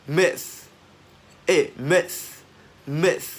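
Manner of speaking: "myth" high and loud, breathy TH ending; teacher drilling the end of "myth"; loud released TH. Shown as a demonstration. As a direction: punch "myth" and push air out on the TH. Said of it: "bật càng to thì càng hay".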